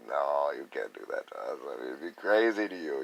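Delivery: ordinary talk, nothing marked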